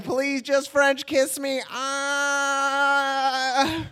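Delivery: high, drawn-out mock plea ending in a wail; hammed-up teenage pleading; desperate begging. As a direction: beg slowly in a high, needy voice. Smitten teen begging in retold scene.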